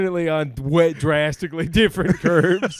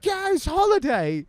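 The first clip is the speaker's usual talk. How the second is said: mom's voice; 11 semitones higher than usual. Retold or imitated.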